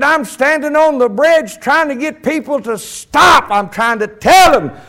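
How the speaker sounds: loud, slow, urgent preaching; high plea with stretched, hammered words; excited old preacher. Retold or imitated. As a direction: cry out a desperate warning. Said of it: "why in the world do you get so excited".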